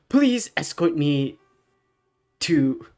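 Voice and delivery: hammed-up formal pleading; mock-grand request. Helpless hero's grand request.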